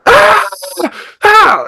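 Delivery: very high re-enacted scream; short, loud imitated screams. Client purging on the couch, re-enacted high scream. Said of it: "started screaming as loud as I could".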